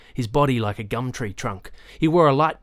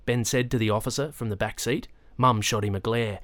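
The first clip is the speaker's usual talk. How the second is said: ordinary delivery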